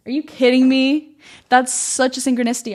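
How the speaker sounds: excited disbelief at the sign; amazed disbelief. Real, not acted. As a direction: marvel out loud at the coincidence. The quote matches her example.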